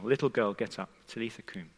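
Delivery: very soft and low, fading away; hushed, tender summons; gentle retold command. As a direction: softly rouse a sleeping child. Jesus gently waking the girl.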